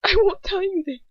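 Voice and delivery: high, pained refusal; near whimper. A narrator's reading. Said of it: "I almost whimper".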